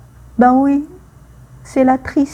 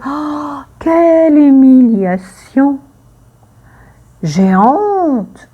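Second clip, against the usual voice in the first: acted shame; 3 semitones higher, 4 dB louder, slower than usual. Shown as a demonstration. Teacher acting out shame phrases.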